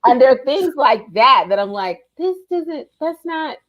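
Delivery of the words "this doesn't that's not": high, indignant voice; high, indignant viewer complaint; mock complaint. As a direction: act out annoyance at the show. Complaining about bad TV sound.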